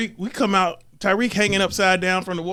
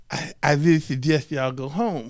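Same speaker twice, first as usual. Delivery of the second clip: put-on Mister Turner accent; 5 semitones lower than usual. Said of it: "The accent is on point".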